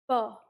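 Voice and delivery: ब said with both lips pressed shut; ब with lips together. Shown as a demonstration. Teacher demonstrating ब to students, lips together. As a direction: close both lips fully for ब. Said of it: "जब हम इस ब को बोलेंगे, तो हमारे होंठ आपस में लगेंगे".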